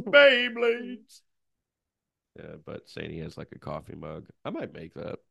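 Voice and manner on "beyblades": very high, loud squeak; high squeaky voice. Pretend. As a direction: squeak it out like a kid. Continues squeaky chant.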